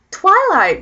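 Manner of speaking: high, loud outburst of disbelief; appalled, pitch jumping up; exasperated rant. Real, not acted. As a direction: spit out the title, loud and high. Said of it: "done ranting".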